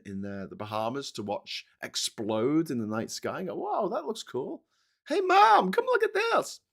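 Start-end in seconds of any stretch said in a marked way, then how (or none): 3.5-6.6 put-on excited kid voice. Impersonating awed kid watching rocket.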